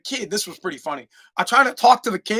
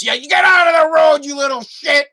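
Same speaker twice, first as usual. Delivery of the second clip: high, loud retold holler; yelling; 6 semitones higher, 4 dB louder than usual. Offended old man to kid in road, imitated yell. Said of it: "I thought he was gonna bitch at me".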